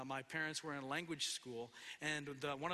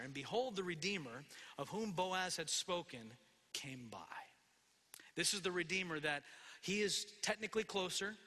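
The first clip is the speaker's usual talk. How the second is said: ordinary delivery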